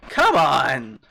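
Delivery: loud exasperated cry after a sigh; frustrated shout. Real, not acted. Streamer annoyed at own mistake, shouts in frustration. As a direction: yell it at yourself for the slip.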